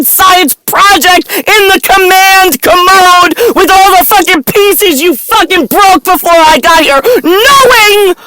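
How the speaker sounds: a furious rant on the edge of tears; shouting rant. Real, not acted. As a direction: yell it out, voice cracking at the end.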